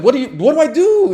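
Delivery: high, loud, hurried plea; panicked question. Retold or imitated. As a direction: replay helpless panic, fast and high. Acts own panic.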